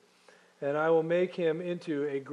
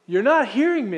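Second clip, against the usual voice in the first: raised, high-pitched correction; emphatic God; 6 semitones higher, 7 dB louder than usual. Acted. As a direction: exclaim it as God, insisting on being heard. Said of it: "You can almost hear God going".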